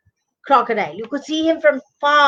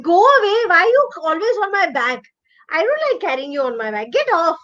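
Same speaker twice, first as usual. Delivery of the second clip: higher voice, fed up; annoyed crocodile; 4 semitones higher than usual. Acted. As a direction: grumble him off your back.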